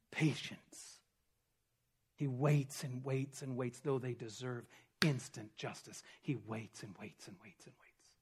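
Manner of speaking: hushed whisper of patience. Real, not acted.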